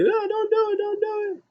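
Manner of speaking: very high falsetto pleading; mock pleading. Onlookers before the chokeslam, a high mock-panicked plea. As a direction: squeal it like panicked onlookers, hammed up.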